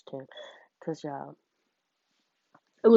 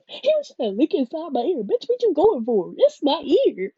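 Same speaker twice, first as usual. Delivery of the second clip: much higher and louder, half-laughing indignation; outraged and amused; 11 semitones higher, 12 dB louder than usual. Real, not acted. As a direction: yell it in amused disbelief. Laughing outrage at the ear licking.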